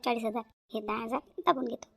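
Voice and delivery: whisper-like scheme under the breath; sly aside. Acted. Pocketing ten thousand from the aunt, hushed sly aside.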